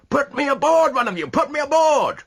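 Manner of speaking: high, loud desperate yell. A narrator's reading. Rashleigh yelling to be put aboard, frantic loud demand. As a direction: hammer the demand at the crowd. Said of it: "yelled Rashleigh".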